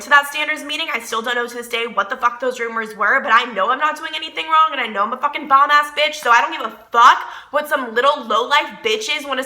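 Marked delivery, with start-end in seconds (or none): none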